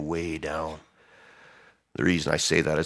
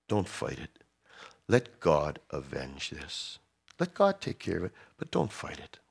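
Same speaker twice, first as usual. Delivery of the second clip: gentle counsel; 2 semitones lower, 7 dB quieter than usual. Retold or imitated. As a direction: urge him tenderly to let go.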